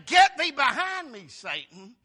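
loud scripture rebuke. Retold or imitated. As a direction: thunder the verse out. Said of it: "he rebuked Peter, saying".

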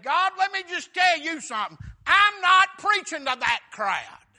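high, loud preacher's outburst; defiant shout. Acted. Jonah flatly refusing God's order, defiant shout. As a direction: dig in and refuse at full volume. Jonah acted as defying God.

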